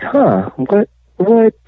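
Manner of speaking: high, bewildered huh what, rising; confused huh what. Retold or imitated. Retelling his bank card failing, high bewildered stammer. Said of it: "At this point, I'm just confused".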